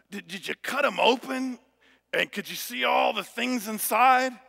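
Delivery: put-on high, eager little boy voice. Young son quizzing dad about surgery, high and eager. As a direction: play the excited young son pestering dad. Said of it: "his son said".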